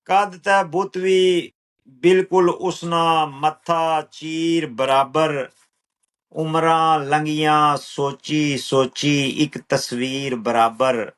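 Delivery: slow, measured verse with drawn-out words; poetry recital. Acted. Poet reciting own lines to an appreciative listener, measured recital.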